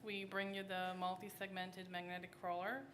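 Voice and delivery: ordinary talk, nothing marked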